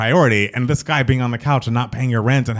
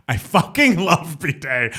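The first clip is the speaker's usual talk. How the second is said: laughing gush; 4 semitones higher, much slower than usual. Real, not acted. Gushes over favorite album.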